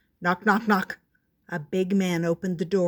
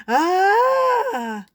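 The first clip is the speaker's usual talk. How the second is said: long high scream, held out; startled yell; 12 semitones higher, 7 dB louder than usual. A narrator's reading. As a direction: yell out in fright, high and loud. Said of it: "turned around and yelled".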